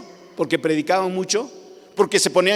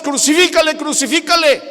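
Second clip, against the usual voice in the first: higher, louder crowd cry; crowd shouting; 11 semitones higher, 8 dB louder than usual. Retold or imitated. The crowd demanding crucifixion, a mock mob shout. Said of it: "Y nosotros gritábamos".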